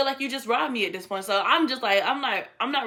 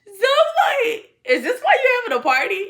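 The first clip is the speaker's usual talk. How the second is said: high, loud question squeezed through laughter; laughing hard; 12 semitones higher, 7 dB louder than usual. Retold or imitated. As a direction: ask it while still cracking up. Laughs hard retelling her question.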